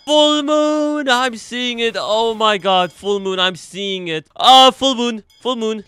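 high, thrilled exclamations; excited calling out. Real, not acted. Streamer spotting the awaited full moon, high excited cries.

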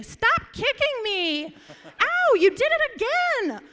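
high, loud, slow complaint; loud protest. Retold or imitated. Brother protests being kicked under the table, high and loud. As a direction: yell out the secret kick for everyone. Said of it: "he would say".

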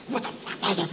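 softened, under-the-breath outrage; muted scandalized question; hushed disbelief. Retold or imitated. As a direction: mouth it discreetly but indignantly. Vicky hisses during funeral.

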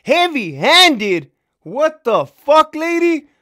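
high, loud, slow incredulous yell; outraged disbelief. Retold or imitated. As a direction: yell it in shock. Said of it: "I said".

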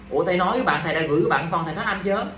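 ordinary delivery

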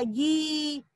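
गी with a drawn-out ee; long vowel. Shown as a demonstration. Teacher showing the new syllable to children, long-held गी. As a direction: hold the vowel in गी. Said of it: "गा पे बड़ी की मात्रा लगा दी थी".

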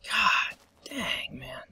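breathy whispered groan; whispered curse. Real, not acted. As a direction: mutter it under your breath. Mutters after loss.

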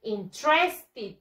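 interested slowly as "in-TRES-tid"; slow repeat-after-me "in-TRES-tid"; correct pronunciation. Shown as a demonstration. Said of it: "una vez más".

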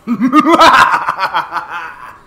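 loud evil villain cackle; evil laugh. Acted. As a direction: cackle like a supervillain. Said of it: "my evil laugh there".

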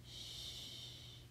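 whispered, fading hush; whispering over a crib. A narrator's reading. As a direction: hush a sleeping child. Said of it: "the zebra said".

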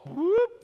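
high rising whoop; loud, upswept device-style "whoop"; imitated activation chime. Preacher mimics brains activating like Alexa, high rising whoop. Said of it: "their brains activate".

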